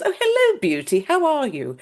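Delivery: high, lilting British-sounding greeting; hammed-up British-schooled lady's accent; soft British-sounding accent. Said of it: "very soft accent like that".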